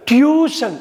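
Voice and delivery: "டியூஷன்" as "tyoo-shun", high first syllable; correct pronunciation. Shown as a demonstration. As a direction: pitch up "டியூஷன்" with a y-glide after t. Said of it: "டியூஷன் கிடையாது".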